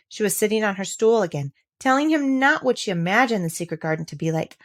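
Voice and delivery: ordinary delivery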